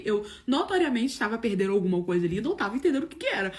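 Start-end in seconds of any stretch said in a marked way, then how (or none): none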